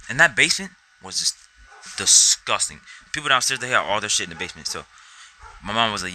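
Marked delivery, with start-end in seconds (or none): none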